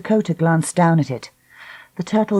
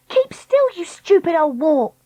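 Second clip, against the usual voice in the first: high, exasperated command; angry warning; 12 semitones higher than usual. Acted. Dakota scolding Treacle on the breaking trolley, exasperated. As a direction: scold her urgently, pitched up.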